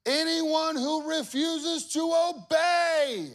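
loud, slow royal proclamation; king's decree. Acted. Preacher booming the king's decree to the crowd. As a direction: bellow out the decree slowly.

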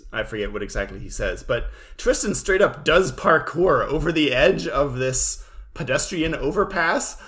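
ordinary talk, nothing marked

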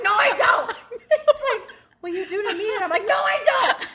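retelling a back-and-forth with her husband, laughing; laughing quoted denial. Retold or imitated. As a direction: deny it while cracking up.

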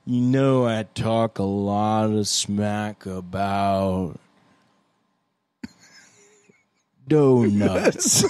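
very slow with a long pause; half-speed playback. Retold or imitated. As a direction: stretch it like a slowed recording. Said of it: "even the shortest pause becomes an eternity on half speed".

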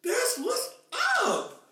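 a hammed-up thrilled reaction; excited reaction. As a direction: gush like you just heard a great song. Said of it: "I was like".

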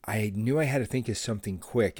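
ordinary delivery

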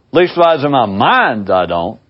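ordinary talk, nothing marked

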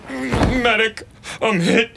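mock cry for a medic; wounded soldier gasping. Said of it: "Jack plays wounded soldier".

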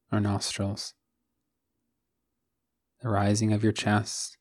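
calm, even meditation voice with long pauses; guided meditation. Acted. Host guiding listeners to settle into the body, calm guided meditation. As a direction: guide them gently into stillness, one phrase at a time.

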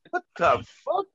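high-pitched retold shock; baffled disbelief. Wrestler reacting to a stranger's insult, incredulous. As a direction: act out being floored, voice shooting up.

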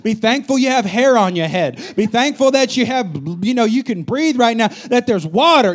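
hammed-up be thankful lecture; silly preaching. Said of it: "being silly with them".

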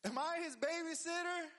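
high-pitched, mock-indignant question; indignant retort. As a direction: throw it back as an affronted comeback. Repeats Cain's indignant retort.

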